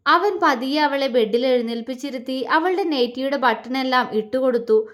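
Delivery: ordinary delivery, nothing marked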